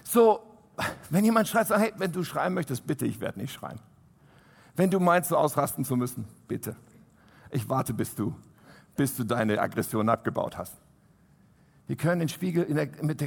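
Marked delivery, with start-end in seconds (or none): 1.8-10.8 leise, tief und gelassen, ohne zu schreien; betont ruhig. Shown as a demonstration. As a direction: sanft und gefasst dem Schreienden antworten. Ruhige Antwort auf Schreienden, vorgeführt. (ruhig und leise einem Schreienden antworten)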